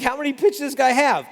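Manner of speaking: the batter wonders aloud, thrown off; baffled batter. Retold or imitated. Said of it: "the batter's like".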